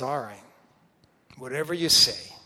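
loud, put-upon giving in; reluctant sighing compliance. Acted. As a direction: give in with exasperated emphasis. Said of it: "Peter kinda sighs and says".

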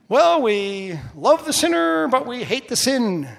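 high, loud, drawn-out sanctimony; mock pious. Mimicking the saying we grew up with, mock pious. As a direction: sing out a pious platitude, exaggerated.

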